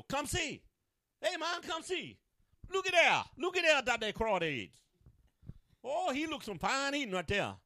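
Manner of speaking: Cajun imitation. Preacher imitating Cajun kids pointing at crawdads, high and loud. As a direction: call the boys over in a put-on Cajun voice. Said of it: "They go".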